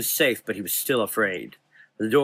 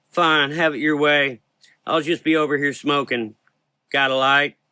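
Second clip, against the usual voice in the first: higher, louder sassy woman; sassy Rose; 5 semitones higher, 5 dB louder than usual. Acted. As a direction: toss it off sarcastically. Rose voiced, character voice.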